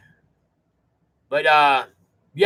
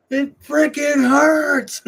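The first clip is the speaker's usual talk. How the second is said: high, slow, loud cry of pain; replaying his answer to his wife, a high pained yell; pained mock yell; 11 semitones higher, 4 dB louder, much slower than usual. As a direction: drag it out like the cramp won't quit. Retold cramp, answering wife.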